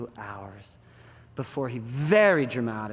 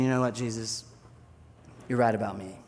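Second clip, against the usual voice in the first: lower, subdued confession; resigned admission; 4 semitones lower, 3 dB quieter than usual. Acted. Peter admits failure to Jesus in the boat, resigned. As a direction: own up quietly in defeat.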